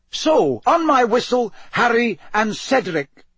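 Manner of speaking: high, loud announcer; starter's call. A narrator's reading. Bagman readies Harry and Cedric for the whistle, high starter's call.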